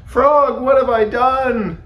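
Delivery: high, loud wail of regret; distressed cry. A narrator's reading. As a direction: cry out for your lost friend. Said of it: "cried Toad".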